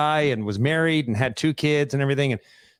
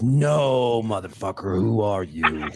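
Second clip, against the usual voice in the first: imitating a stunned man, sinking into a much lower voice; retold disbelief; 7 semitones lower, slower than usual. Startled coworker rejecting the storyteller's name, retold low disbelief. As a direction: drop low on the demand, mock incredulous. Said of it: "Just like that".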